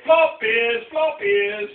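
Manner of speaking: loud high sing-song taunt; teasing yell. Acted. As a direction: chant it loud to tease. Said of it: "yelled Duck and Frog".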